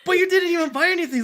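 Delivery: high, loud, fast put-on friend voice; mock the friends' outraged protest; incredulous friend.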